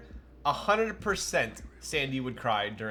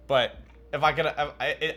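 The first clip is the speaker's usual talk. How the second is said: ordinary delivery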